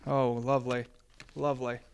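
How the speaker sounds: ordinary delivery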